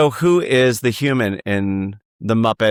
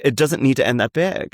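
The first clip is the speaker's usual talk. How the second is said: ordinary delivery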